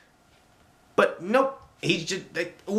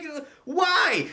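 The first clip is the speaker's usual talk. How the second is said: baffled why; 10 semitones higher than usual. Retold or imitated. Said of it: "I'm just like".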